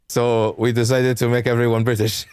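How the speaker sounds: halting, hammed-up French accent; fake French accent. Game company answers why there are no French voices, fake French accent. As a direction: put on a bad French accent. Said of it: "my fake-ass French accent".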